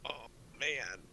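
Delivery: ordinary delivery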